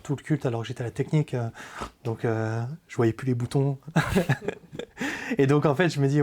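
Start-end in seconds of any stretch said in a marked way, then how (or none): 3.8-5.3 laugh. Real, not acted. Sheepish laugh mid-testimony.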